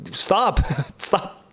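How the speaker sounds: giggling through it, breaking up; real laughter breaking the line; laughing. Real, not acted.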